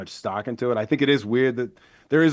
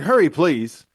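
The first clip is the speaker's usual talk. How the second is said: mildly urgent mock plea, raised pitch; louder, higher retold appeal for help; 6 semitones higher, 8 dB louder, slower than usual. Mother pleading with emergency operator, retold as urgent.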